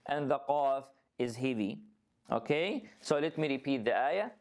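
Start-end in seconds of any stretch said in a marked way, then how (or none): none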